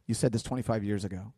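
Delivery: mock-sarcastic Abram, underplayed; eye-rolling sarcasm. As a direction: roll your eyes and brush God off. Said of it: "rolled his eyes and said".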